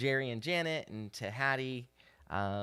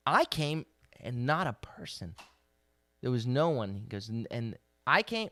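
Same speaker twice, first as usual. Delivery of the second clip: louder, impassioned sermon voice; fervent preaching; 3 semitones higher, 5 dB louder than usual. Acted. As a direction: proclaim it like God pleading with his people. Preaches God's words with rising force.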